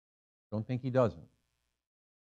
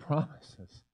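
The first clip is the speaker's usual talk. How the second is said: ordinary delivery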